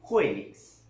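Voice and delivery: Juélix, the F said as a breathy "ju"; Amazonian Félix. Shown as a demonstration. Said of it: "y ellos como dicen".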